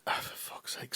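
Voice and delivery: whispered, under-the-breath curse; muttered curse. Retold or imitated. Narrator muttering over the voicemail, whispered curse. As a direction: mutter it to yourself, fed up.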